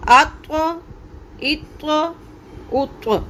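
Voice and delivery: अत्व, इत्व, उत्व with a full bouncing त्व; echoed heavy release. Shown as a demonstration. Teacher demonstrating the correct echoed त्व. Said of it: "इसकी मेरे साथ practice कीजिए".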